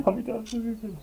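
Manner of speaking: a hint of a sly nurse; lightly voiced nurse. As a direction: play the nurse with a high lilt. Voicing the nurse's line.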